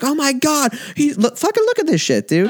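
high, frantic commentator disbelief; Jim Ross call. Retold or imitated. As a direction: gasp it out like a commentator seeing a huge bump. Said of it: "but he'd be like".

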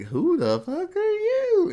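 hammed-up supervillain snarl; gruff villain challenge. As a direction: drag out a mock menacing demand. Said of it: "And he's like".

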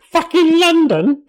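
high silly king voice through a laugh; Henry the Fourth voice. Pretend. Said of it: "we've both got the same Henry the Fourth voice".

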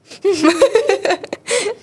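high, loud burst of laughter; helpless laughter. Real, not acted. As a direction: laugh until it almost turns to crying. Laughing at teacher's reprimand.

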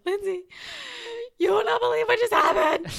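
high, loud, laughing burst of news; can barely hold it in, laughing; excited rush. Retold or imitated. Calling her best friend with big news, laughing and excited.

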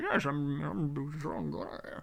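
a hint of a murmured Bane; lightly voiced Bane as Connery. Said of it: "He's just like".

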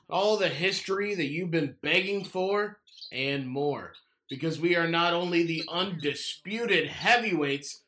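hyped-up announcer intro. Acted. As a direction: grandiose showman sales pitch. Grand podcast intro read.

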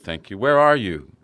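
ordinary delivery

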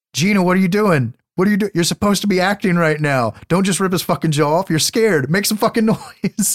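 high, loud, exasperated director shouting at his actress; mock-indignant high-pitched yell of a director scolding his actress; Cronenberg yelling. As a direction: play a frustrated director barking notes from behind the camera. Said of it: "you can hear fucking Cronenberg in the background is like".

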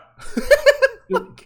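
bursting high cackle; loud high laugh. Real, not acted. Friend laughing hard after telling the cop story. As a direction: crack up loudly at the memory.

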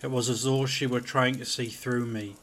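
ordinary delivery, nothing marked

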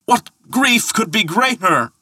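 high, theatrical lament; grief-stricken wail. Acted. Thor lamenting his mother to himself, wailing high.

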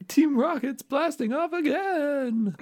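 high, stretched-out mock wail; Team Rocket catchphrase cry. Podcaster imitating Team Rocket blasted away, high drawn-out mock cry. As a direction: draw it out high, as if flying off into the distance. Quotes show's catchphrase, cried out.

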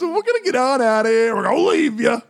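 high, put-on goodbye voice; hammed-up sign-off. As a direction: squawk out a silly farewell.

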